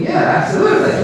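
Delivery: high, loud mock agreement. Doctor humouring his patient in his head, mock agreement. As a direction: humour him with an over-keen, high-pitched yes.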